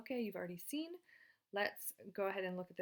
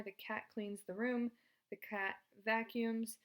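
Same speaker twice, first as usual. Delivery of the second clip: slow dictation; 2 semitones higher, slower than usual. Shown as a demonstration. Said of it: "Combine that into one sentence".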